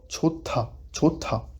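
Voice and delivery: CHOTA said as two clear syllables; pronúncia demonstrada. Shown as a demonstration. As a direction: say "chota" slowly, as a model word. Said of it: "on le lira".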